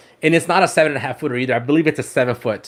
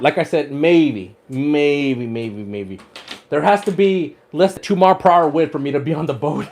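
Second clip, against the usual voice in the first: usual speaking voice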